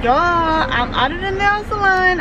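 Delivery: excited announcement. Real, not acted. Vlogger updating viewers outside the nail salon, excited.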